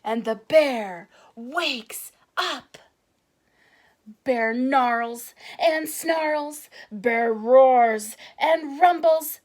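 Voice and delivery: loud, slow growly telling; rumbling narration. A narrator's reading. Narrator showing the angry bear, slow and loud.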